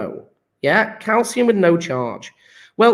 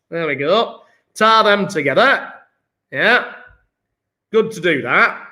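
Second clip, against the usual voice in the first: jokey put-on Yorkshire lilt; Yorkshire accent. Shown as a demonstration. Teacher carrying on the redox lesson, mock Yorkshire accent. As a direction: ham up a broad Yorkshire accent. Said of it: "rest of lesson in Yorkshire".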